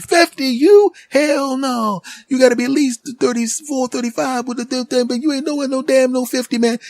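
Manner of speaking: high, loud excited clerk; store clerk voice. Retold or imitated. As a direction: play the hyped-up shop guy calling out.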